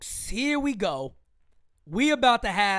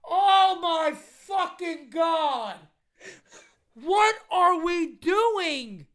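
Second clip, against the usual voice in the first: high, crying-laughing outburst of disbelief; exasperated disbelief; 8 semitones higher than usual. Real, not acted. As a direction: shriek in real exasperation. Stunned by runner caught stealing.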